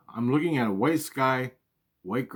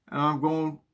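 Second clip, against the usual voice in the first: ordinary talk, nothing marked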